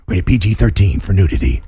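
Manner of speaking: deep voiced rating announcement; movie trailer voice. Shown as a demonstration. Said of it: "the whole trailer voice thing".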